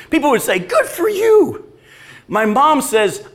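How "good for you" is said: high, drawn-out pretend cheer; mock congratulation. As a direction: imitate friends gushing over holiday news. Voices people cheering cruise news.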